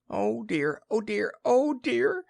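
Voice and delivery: high, squeaky little Piglet voice; high-pitched worried piglet; fretful Piglet. Acted. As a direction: fret to yourself as tiny Piglet. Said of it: "said Piglet to himself".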